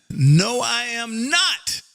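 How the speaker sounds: normal talking voice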